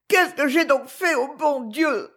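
high, anguished lament; desolate cry. A narrator's reading. Woman repeating another's cry to God, desolate. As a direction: plead upward in desolation. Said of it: "elle le répéta avec la même désolation".

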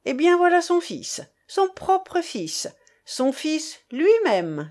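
high, emphatic presentation; insistent denouncing. A narrator's reading. Man presenting the son to angry women, high insistence. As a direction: drive each phrase home, high and drawn out.

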